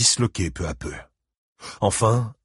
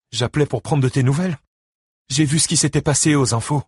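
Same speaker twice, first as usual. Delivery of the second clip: higher, lively woman's voice; anxious ex-wife; 7 semitones higher, 4 dB louder, faster than usual. Acted. Christine phones her ex-husband for news, voiced high and concerned. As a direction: check in on him, concerned.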